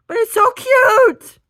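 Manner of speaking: very high, loud, slow gushing squeal; mock gushing over a cute dog; delighted squeal. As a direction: squeal it, stretched and thrilled.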